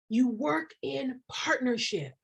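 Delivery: slow, hushed whisper; emphatic whisper. Real, not acted. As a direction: lean in and whisper the key point.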